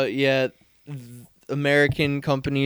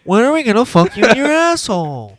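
much higher, louder radio-host voice; Howard Stern impression; 11 semitones higher, 8 dB louder than usual. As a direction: bellow it like a shock jock. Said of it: "that's Stern".

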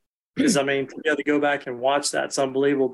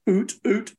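higher, airy oot sounds; duck call oot; 5 semitones higher than usual. Shown as a demonstration. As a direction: push oot with startup torque.

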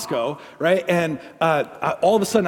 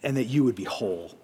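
quiet, lower, slow heartfelt prayer; soft prayer; 5 semitones lower, 7 dB quieter than usual. Acted. A pastor prays softly over hurting listeners in a lowered, slow voice. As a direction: pray over them gently, pausing often.

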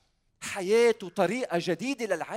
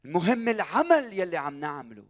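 normal talking voice

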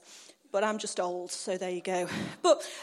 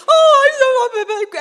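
high, loud, drawn-out mock relief; overjoyed gushing; 8 semitones higher, 12 dB louder than usual. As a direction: gush over the recovered dog. Relief at finding the dog.

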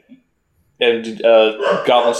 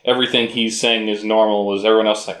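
usual speaking voice

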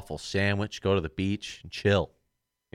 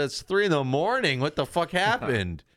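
high-pitched, bewildered exclamation; retold shock at lost time; mock disbelief; 9 semitones higher than usual. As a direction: pitch it right up in acted-out alarm.

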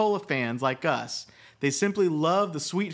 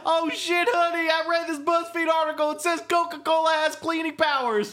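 high, silly gullible husband; high mock husband voice; 11 semitones higher than usual. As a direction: play a dim husband, pitched up.

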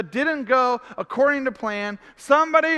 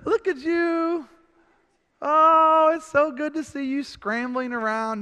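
mocking distant God; 4 semitones higher, slower than usual. Mocking God voiced in sermon.

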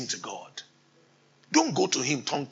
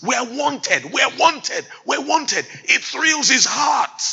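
high, loud joyful repetition; excited preaching; exultant declaration; 8 semitones higher, 3 dB louder than usual. Real, not acted. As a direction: lift them with the good news.

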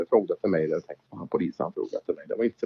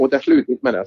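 excited punchline; 6 dB louder than usual. Real, not acted. Punchline of the story.

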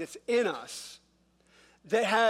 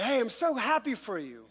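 higher, fake-gushing congratulations; passive-aggressive; 4 semitones higher than usual. Grumbler congratulating someone they resent, passive-aggressive. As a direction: put on a syrupy show of being happy for them. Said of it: "filled with passive aggressiveness".

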